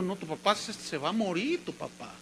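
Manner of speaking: soft, dropping away at the end; hushed bad news. Doctor silently conveying to the son his father will die, hushed. Doctor's look voiced as words.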